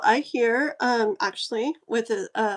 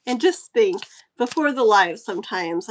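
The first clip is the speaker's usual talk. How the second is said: normal talking voice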